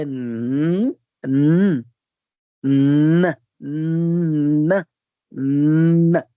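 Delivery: N held as a long nasal nnn; drawn-out N sound. Shown as a demonstration. Teacher demonstrating letter N, long nasal hum on N. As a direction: sound the N, not its name, stretched out. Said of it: "Já o N".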